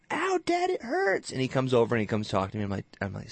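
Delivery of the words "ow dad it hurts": put-on high whiny child voice; hurt little kid. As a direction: whimper it like a hurt little boy. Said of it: "and Seth's like".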